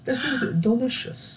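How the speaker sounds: high, put-on British judge voice; mock-prim British accent; monotone British accent. Baking show judge tasting food, monotone British accent. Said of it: "very monotone British".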